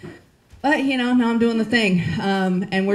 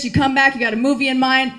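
usual speaking voice

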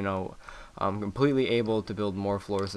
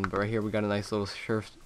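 ordinary delivery

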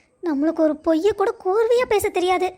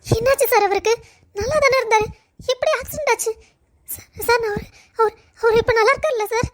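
anxious; 4 semitones higher than usual. Acted. Wife hears of husband's accident.

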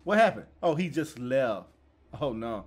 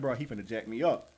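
nervous mutter; 7 semitones lower, 5 dB quieter than usual. Real, not acted. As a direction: lower and softer worry. Waiting with back turned.

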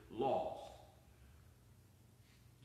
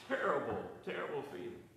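usual speaking voice

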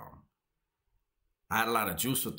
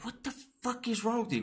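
shocked outburst; faster than usual. Retold or imitated. Walking on his cellmate's back, shocked by his ribs, high disbelieving outburst. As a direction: pitch jumps way up in disbelief. Feeling Kong's bumpy ribs.

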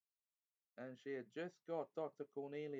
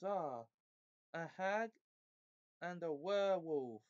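outraged, high and loud at first, easing slightly; King Caspian thundering; 6 semitones higher, 4 dB louder than usual. A narrator's reading. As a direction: confront the plotters in ringing indignation. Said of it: "rose the voice of King Caspian like thunder".

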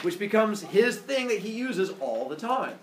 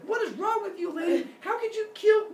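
high, hammed-up yell. Apollo in the middle of yelling at Maya, shouting. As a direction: scold at the top of your range. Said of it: "in the middle of yelling at Maya".

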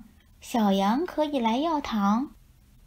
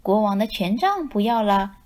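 ordinary delivery

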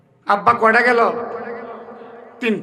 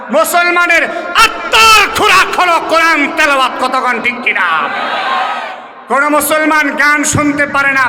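raised, forceful sermon lines; preaching loudly; 3 semitones higher, 4 dB louder than usual. Acted. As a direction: drive the point home to the crowd. (জোরালো ওয়াজ)

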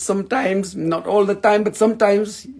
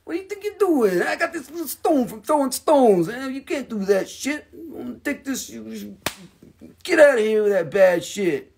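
high, hammed-up scolding of the world with a stone in hand; mock telling off the world; 5 semitones higher, 3 dB quieter than usual. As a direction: pretend to scold the whole world, half-joking. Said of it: "You can be like".

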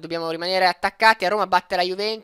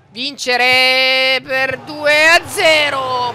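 loud drawn-out urging; 7 semitones higher, 11 dB louder, much slower than usual. Real, not acted. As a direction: stretch it out loud and high. Urging team to win. (urlato lentamente)

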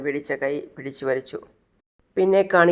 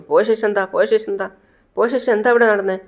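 acted impatient demand, pitched higher; urgent questioning; 6 semitones higher, 3 dB louder than usual. Boss demanding what happened after he left, urgent questioning. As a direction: cut in and press for the answer, pitch raised.

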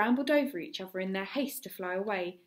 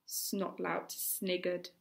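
usual speaking voice

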